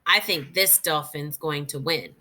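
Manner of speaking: normal talking voice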